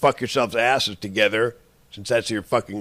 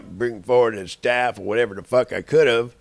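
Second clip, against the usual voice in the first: ordinary delivery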